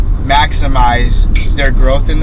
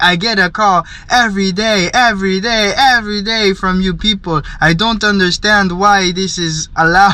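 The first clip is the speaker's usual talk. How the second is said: mock African accent, raised pitch, hammering each every; imitated African accent; 5 semitones higher than usual. Man complaining about daily scam calls, imitated African accent. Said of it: "the African, uh, accent and everything".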